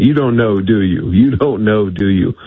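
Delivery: a hint of a Cajun accent, teasing; mildly imitated Cajun accent, needling the official. Senator taunting an official who can't answer, light Cajun accent. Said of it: "in his great Cajun accent".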